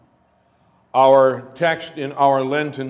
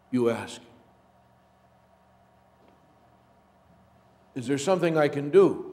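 hushed story narration; 3 dB quieter than usual. Acted. Pastor leads the congregation into a story, hushed.

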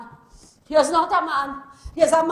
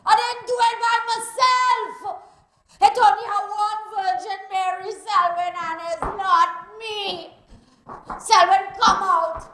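angry Caribbean woman yelling curses at a man's house; furious cursing woman; 11 semitones higher, 8 dB louder than usual. Acted. Jilted woman outside Selwyn's house, furious yelling. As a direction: rage at him, loud and raw.

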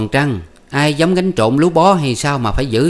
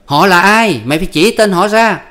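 high, loud bickering voices; husband and wife arguing at night, high and loud; heated quarrel; 6 semitones higher, 5 dB louder than usual. Acted. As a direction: snap back and forth, pitch raised. Voiced marital quarrel continues.

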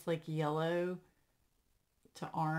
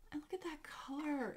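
higher, hushed whisper, moved; awed whisper; 8 semitones higher, 5 dB quieter than usual. Real, not acted. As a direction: whisper it, close to tears. Moved by memorial gift.

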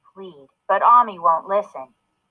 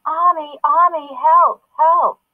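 mock yelling for help; 8 semitones higher, 4 dB louder, slower than usual. A narrator's reading.

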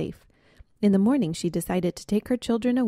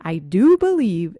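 slow realization; 9 dB louder, slower than usual. Acted. Realizing they bit each other.

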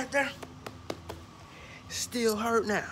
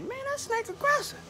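mock high voice. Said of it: "I'm like".

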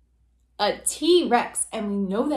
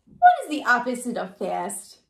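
usual speaking voice

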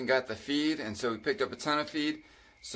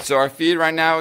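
usual speaking voice

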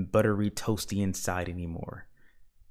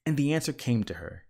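plain reading voice

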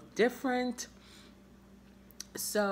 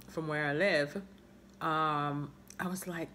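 ordinary delivery

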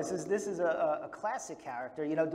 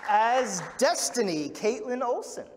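loud, drawn-out cast introduction; announcer introduction; 4 semitones higher, 6 dB louder, slower than usual. Acted. Host presents the movie's cast to the audience, announcer style. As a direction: slowly announce each name to the crowd.

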